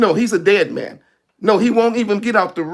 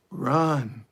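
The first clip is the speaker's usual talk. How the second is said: inner voice; 10 semitones lower, 8 dB quieter than usual. Acted. Inner voice says run.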